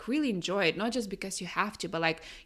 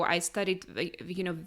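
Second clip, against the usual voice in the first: ordinary delivery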